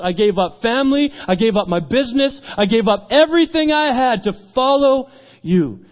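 fervent list building to a high peak; impassioned declaration. Retold or imitated. Peter recounts his total sacrifice.

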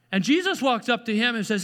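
ordinary delivery

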